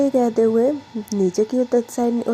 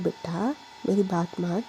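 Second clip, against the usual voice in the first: softer, lower, tender grandmother coaxing; lovingly; 3 semitones lower, 8 dB quieter than usual. Acted. Grandma strokes Pragya's cheek and coaxes her, tender. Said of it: "अभी तुझे प्यार से बोल रही हूँ".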